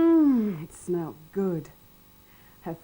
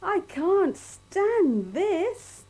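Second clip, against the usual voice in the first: slow, high, louder exasperated groan; fed-up bear; 11 semitones higher, 7 dB louder, much slower than usual. A narrator's reading. Mister Bear kept awake by snoring, slow exasperated wail. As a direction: drag it out in despair. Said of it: "said Mister Bear".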